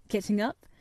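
usual speaking voice

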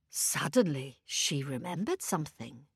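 soft and low, building suspense; hushed. A narrator's reading. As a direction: lean in and hush. Suspense hush.

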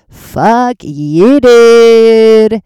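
loud, drawn-out angry retort. Retold or imitated. To a stranger who didn't smile back, a loud drawn-out retort.

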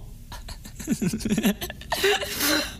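high, unhinged giggle that falls away; soft deranged cackle; creepy laugh. Acted. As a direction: let a manic little laugh escape. Captor laughs at captive's fear.

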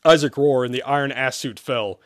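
ordinary delivery

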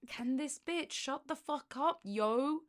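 annoyed neighbour. Retold or imitated. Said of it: "they must be like".

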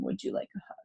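hushed, breathy-free whisper of a gentle question; whispered offer. Shown as a demonstration. Parent whispers to an upset child offering a hug, whispered. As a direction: whisper it softly to calm a child.

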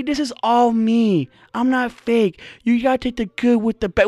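mock her earnest cosmic self-acceptance speech; Kiera Mia. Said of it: "So she's telling her".

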